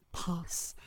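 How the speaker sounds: whispered trance cue. Acted. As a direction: breathe the word out.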